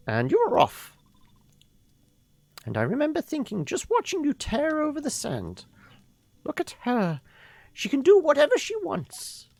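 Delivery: put-on high, airy old woman voice; a fond grandmother reminiscing; old lady voice. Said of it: "So we should do the old lady voice".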